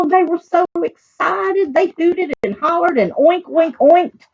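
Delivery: put-on high, lively read to children; excited storytelling. A narrator's reading. Reader tells of pigs thrilled about school, excited.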